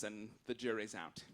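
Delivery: ordinary delivery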